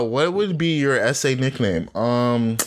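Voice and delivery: ordinary talk, nothing marked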